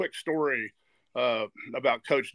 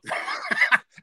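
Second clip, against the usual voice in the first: laugh; 12 semitones higher, 4 dB louder than usual. Real, not acted. Laughs at his own memory.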